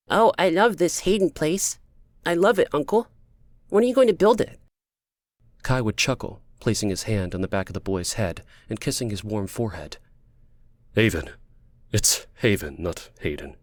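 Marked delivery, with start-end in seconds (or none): 0.1-4.6 put-on high, eager child voice; high-pitched childish enthusiasm; little boy. Little boy talks to his uncle. 10.9-13.6 "Haven" said firmly with a clear v; gentle correction. Acted. Uncle corrects the boy's pronunciation.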